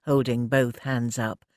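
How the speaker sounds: ordinary delivery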